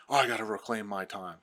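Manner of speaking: snide, dismissive mock politician voice; a mild mock of a lawmaker brushing off questions; mocking a Democrat congressman. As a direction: sneer it like a smug official dodging. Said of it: "people don't talk like that".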